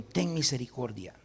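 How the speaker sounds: very soft, low prayer; hushed acted begging for mercy; humble plea. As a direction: plead quietly, under your voice.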